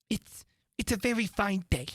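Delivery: lightly voiced nervous stammer; hint of a shy rabbit; timid rabbit. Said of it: "said a timid voice".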